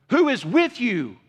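WHO hit high and loud, slow; slow, loud emphatic question; preacher punching who; stress on WHO. Real, not acted. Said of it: "key question repeated".

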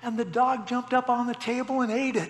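lightly hammed-up teary complaint; mock tearful wife. Young wife telling her husband about ruined supper, mock tearful. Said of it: "she, through her tears".

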